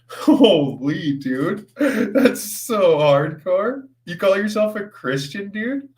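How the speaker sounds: high, laughing incredulous outburst; laughing disbelief. Real, not acted. Streamer laughing in disbelief at a rude viewer.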